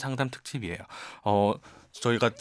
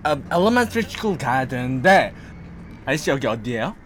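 put-on Korean American accent; 2 semitones higher, 4 dB louder, slower than usual. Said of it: "계속해주세요".

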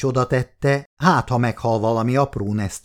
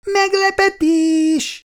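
much higher, slow drawn-out cheer; surprise shout; 3 dB louder, slower than usual. Acted. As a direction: sing out the surprise. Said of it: "kiáltottak valakik az autóból".

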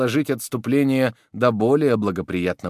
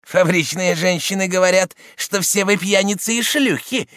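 raised, high goading voice; little man shouting; 9 semitones higher, 4 dB louder than usual. A narrator's reading. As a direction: shout the insult to stir them up. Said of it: "кричал человечек с физиономией".

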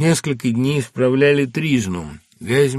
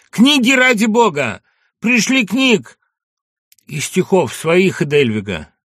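desperate shout; 10 semitones higher, 7 dB louder than usual. Acted. Prisoner begs while dragged away.

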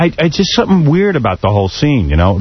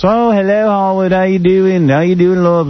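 imitating her Australian accent, higher and slow; sweet, drawn-out Australian greeting, lightly hammed-up; mock Australian accent; 6 semitones higher, slower than usual. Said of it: "she got some Australian accent".

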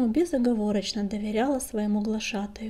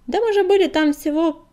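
higher, louder, hurriedly cutting in; hasty interjection; 6 semitones higher, 8 dB louder than usual. A narrator's reading. Огнегриф covers for his friend's slip before Коготь, hastily cutting in. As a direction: jump in quickly to cover a slip. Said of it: "торопливо вставил Огнегриф".

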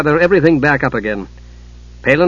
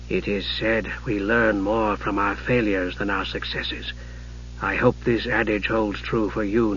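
hushed sorcerer uncle, measured and solemn; grave, cold mage; 4 semitones lower, 9 dB quieter than usual. Acted. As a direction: lecture quietly and gravely, weighing each word. Narrator voices Raistlin admonishing nephew.

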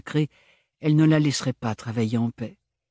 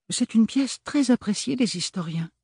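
higher, crisp and businesslike; professional tone; 11 semitones higher than usual. Acted. Museum keeper reassuring customers, professional. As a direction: answer like an expert at the counter. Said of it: "d'un ton professionnel".

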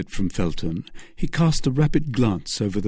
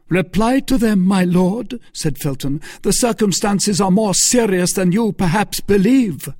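much higher, louder demand; insistent outburst; 10 semitones higher, 6 dB louder than usual. Acted. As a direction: force the duke to answer. Felton confronting the duke.

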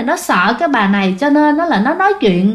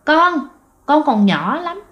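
put-on hellish child ghost voice; 6 semitones higher, slower than usual. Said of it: "nghe nó âm trì địa ngục".

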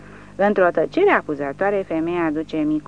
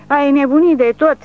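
"Ai nebunit" shrieked much higher and louder; shouting; 9 semitones higher, 10 dB louder than usual. A narrator's reading. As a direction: scream "nebunit" at him in panic. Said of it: "țipă ea".

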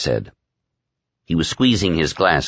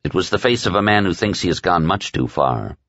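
usual speaking voice

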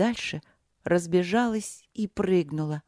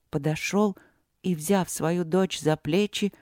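ordinary delivery

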